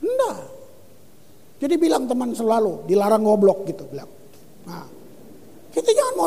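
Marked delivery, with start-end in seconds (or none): 0.0-0.4 sharp no. Real, not acted. Preacher flatly refusing.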